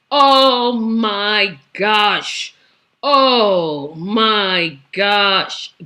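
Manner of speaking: very slow, long-drawn-out dismay; exasperated. Real, not acted. Commentator reacting to the next name on her list, exasperated. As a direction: stretch every word in exasperation.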